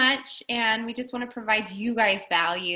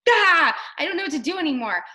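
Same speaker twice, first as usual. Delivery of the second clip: higher, louder exasperated outburst; overwhelmed; 6 semitones higher, 5 dB louder than usual. Acted. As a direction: blurt it out as if overwhelmed. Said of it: "overwhelmed easily".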